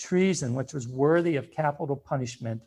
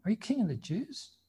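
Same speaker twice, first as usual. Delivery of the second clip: higher, softer, rising curious question; curious questioning Pilate; 6 semitones higher, 7 dB quieter than usual. Shown as a demonstration. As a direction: lean in and ask with curiosity. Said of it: "I always try to get voice inflection in there".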